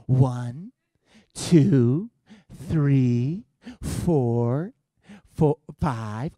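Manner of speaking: drawn-out numbers with threatening rises; parental warning count. Retold or imitated. Dad giving kids till ten, drawn-out warning count. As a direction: stretch each number, stalling before the next. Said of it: "I'm a counter".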